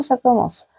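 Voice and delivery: ordinary talk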